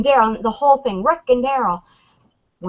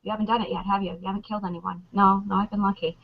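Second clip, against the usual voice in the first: quietly quoted dialogue; 8 dB quieter, faster than usual. Acted. Fan retells Glenn explaining he has killed no one, hushed. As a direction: drop the volume for both sides of the exchange. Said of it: "it was Glenn explaining".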